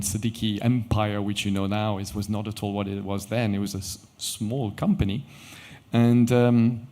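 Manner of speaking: ordinary talk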